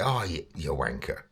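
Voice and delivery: soft, playful jibe; joking. Shown as a demonstration. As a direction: tease a friend, quietly. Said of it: "it's a joke".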